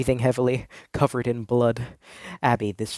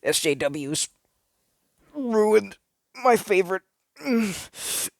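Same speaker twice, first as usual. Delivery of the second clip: high, nasal-free whiny gamer rant at a higher pitch; nerdy gamer voice; 8 semitones higher, slower than usual. Acted. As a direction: play a sulky gamer stammering over his grievance. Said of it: "One guy tried to focus and talk".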